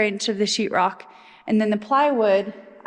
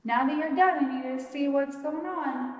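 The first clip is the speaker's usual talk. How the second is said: higher-pitched, lightly voiced imitation of her; imitated project manager; 4 semitones higher, 4 dB quieter than usual. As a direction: playfully speak for her in a raised pitch. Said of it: "She's like".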